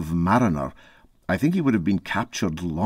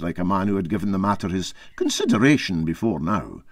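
usual speaking voice

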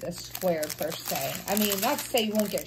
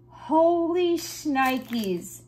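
amazed; 5 semitones higher, 3 dB louder, much slower than usual. Real, not acted. Amazed at special drill.

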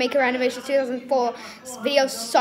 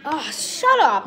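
ordinary talk

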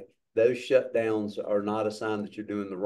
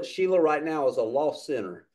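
ordinary delivery

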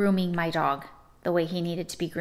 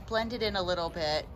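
usual speaking voice

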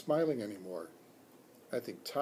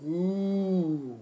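long, higher-pitched ooh of wonder; audience's long marvelling ooh; impressed ooh; 4 semitones higher than usual. A narrator's reading. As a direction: draw out an admiring ooh. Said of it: "my friends all said".

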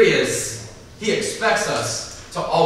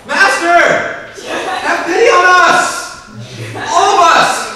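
much higher, louder, slow, laughing mock wail; yelling lepers; 12 semitones higher, 11 dB louder, much slower than usual. Pastor retelling lepers yelling at Jesus, comic laughing mock wail. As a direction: ham up the desperate yelling of ten lepers. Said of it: "they're just like".

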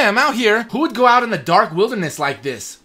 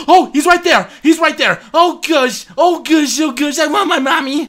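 high frantic shouting; scared out of your wits; high, loud terrified cries; panicked; 8 semitones higher, 5 dB louder than usual. Real, not acted. Chased again.

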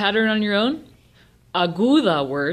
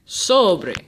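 sobre with a strong, drawn-out SO; stress on first syllable; 4 dB louder than usual. Shown as a demonstration. Teacher saying it one more time, first syllable of sobre stressed. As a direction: hit the SO in sobre and let bre fall. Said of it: "emphasis is on the second to last syllable".

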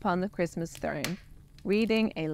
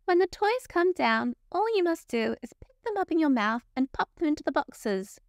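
brisk elf; 8 semitones higher than usual. A narrator's reading. Factory elf showing bear the conveyor, chirpy high voice. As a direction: explain the job in a chipper voice.

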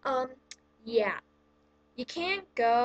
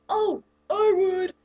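loud, slow, higher mock student voice; eager volunteer; 8 semitones higher, 9 dB louder, slower than usual. Mocking a classmate who offers to read aloud, loud and slow. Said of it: "Who would like to read".

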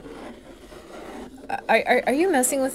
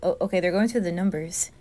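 usual speaking voice

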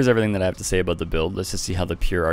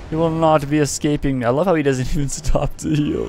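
higher, mocking gloat; gloating taunt; 8 semitones higher than usual. Real, not acted. Taunting the fleeing opponent, gleeful gloating. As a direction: crow over the fleeing foe.

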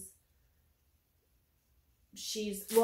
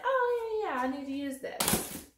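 sudden realization; 7 semitones higher, 3 dB louder than usual. Retold or imitated. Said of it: "and I'm like".

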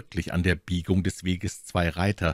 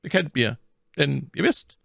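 lightly voiced cardinal, smooth and higher; shrewd cardinal; 10 semitones higher than usual. A narrator's reading. Cardinal requesting escort, lightly voiced and smooth. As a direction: flatter and command at once.

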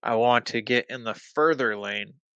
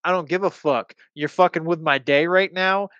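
louder, higher, fed-up outburst; retold angry retort; 5 semitones higher, 5 dB louder than usual. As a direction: fire back at him with raised voice. Own angry reply in quarrel.

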